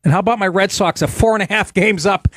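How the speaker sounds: normal talking voice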